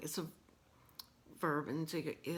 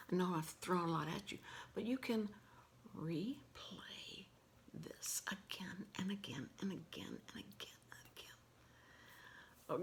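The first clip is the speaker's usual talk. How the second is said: soft, breathy whispered lesson throughout; unvoiced ASMR teaching whisper; 4 dB quieter than usual. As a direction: whisper every word close to the mic, gently. Whispered ASMR sign language lesson.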